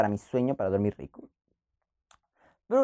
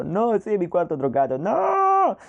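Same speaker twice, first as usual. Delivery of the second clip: panicked pleading; much slower than usual. Retold or imitated. Begging mom not to call.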